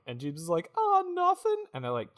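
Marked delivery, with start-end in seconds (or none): 0.8-1.6 very high squeaky denial; fake-innocent pawnbroker. Jeeves in pieces denying what happened, squeaky innocence.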